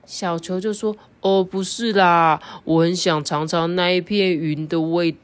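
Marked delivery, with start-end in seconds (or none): 1.2-5.1 slow, dreamy longing voice; drawn-out daydreaming bug; slow dreamy caterpillar. Acted. Xiaoqiu answers dreamily.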